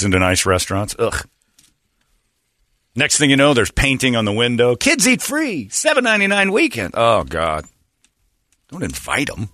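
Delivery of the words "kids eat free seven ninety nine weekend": high, bright sales pitch; hammed-up ad announcer; mock advertisement voice. Mock restaurant ad sign.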